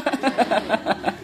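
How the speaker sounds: chuckling in a quick run; laugh. Real, not acted. Master joking about hardship to disciples, real laugh. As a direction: laugh it off in short pulses. Laughs after saying helping is hard.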